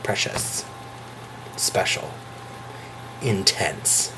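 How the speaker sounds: hushed, breathy awe; reverent whisper. Real, not acted. Vlogger describing the magical show, reverent whisper. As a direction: whisper it like something sacred.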